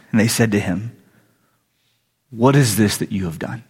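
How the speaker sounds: low, measured Bible reading; scripture reading. A narrator's reading. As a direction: read the verses out soberly, pitched low. Reads scripture aloud.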